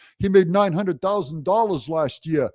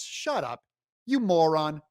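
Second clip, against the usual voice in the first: ordinary talk, nothing marked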